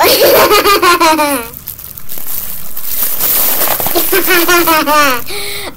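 loud delighted laughter at own squeaky voice; helpless giggling fit; real laugh. Real, not acted. Laughing after hearing helium voice.

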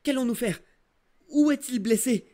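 high, loud, pleading questions; panicked crying out. Acted. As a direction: beg the doctor for help in alarm. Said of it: "avons-nous crié".

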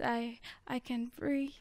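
whispered, fading denial. Acted. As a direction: barely voice it, all air. Whispered echo of the chorus.

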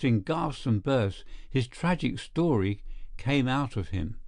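ordinary delivery

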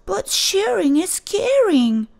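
high, pleading protest; pleading cry. Acted. Clark arguing with the teacher, high pleading. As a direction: insist like a kid who found a loophole. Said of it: "cried Clark".

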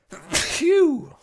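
loud sudden sneeze; a real sneeze bursting out. Real, not acted. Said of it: "Oh, I'm gonna sneeze".

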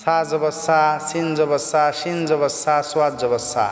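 every সা said alike as a plain "sa"; quick undifferentiated reading, সোয়াদ জবর ending in thin সা; wrong identical pronunciation. Shown as a demonstration. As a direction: run all four letters together with the same "sa". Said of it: "কিন্তু এইরকম হবে না".

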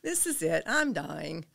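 loud mock dying announcement; hammed-up last words after a laugh; joking panic. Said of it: "I'm kinda joking about it".

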